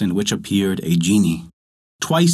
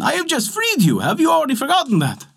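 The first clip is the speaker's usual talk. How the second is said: much higher, panicked protest; a scared little man pleading, pitched way up; frightened fisherman crying out. Acted. Fisherman begs the genie who threatens him, high and frightened. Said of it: "cried the fisherman".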